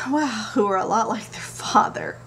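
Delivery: conspiratorial whisper; whispered aside. Real, not acted. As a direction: lean in and whisper the joke. Joking aside about husband.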